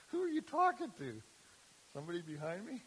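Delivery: high, loud incredulous question; hammed-up bewildered man, pitched high and loud; mock disbelief. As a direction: play the baffled paralytic, way up high. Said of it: "The guy's thinking".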